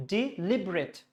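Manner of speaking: deliberate broken as dee... LIB-ret; stressed LIB in deliberate. Shown as a demonstration. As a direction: separate "de" and hit "lib" in deliberate. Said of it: "this portion has to be stressed".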